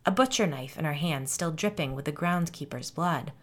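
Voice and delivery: ordinary delivery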